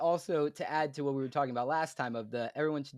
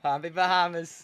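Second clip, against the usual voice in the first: mock holiday greeting; 3 semitones higher than usual.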